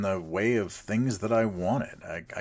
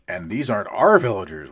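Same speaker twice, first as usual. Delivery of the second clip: ordinary talk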